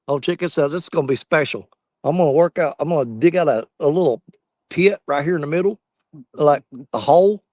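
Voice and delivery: ordinary delivery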